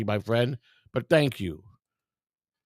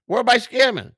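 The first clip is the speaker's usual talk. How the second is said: indignant challenge; 9 semitones higher, 4 dB louder than usual. Real, not acted. Repeats challenge to accuser.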